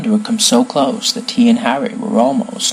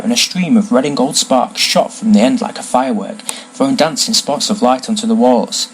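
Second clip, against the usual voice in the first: usual speaking voice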